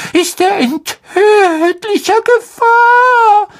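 sehr hohe, langsame, weinerliche Elfenstimme; verzweifelter Hauself. Acted. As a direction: eindringlich warnend, den Tränen nah. Dobby voiced by narrator. (hoher, flehender Elf mit Tränen)

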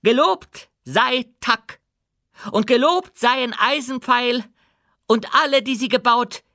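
proud, firm queen addressing her subjects; dwarf queen, commanding. Acted. Queen's closing words.

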